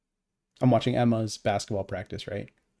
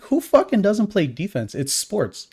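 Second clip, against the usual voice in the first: higher, louder exasperated retort; incredulous rant; 8 semitones higher, 4 dB louder than usual. Retold or imitated. Scoffing at the coach about defense, loud and incredulous. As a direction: scoff like it's obvious. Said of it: "and I'm like".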